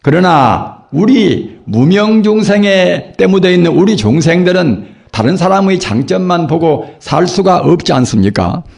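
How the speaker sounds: normal talking voice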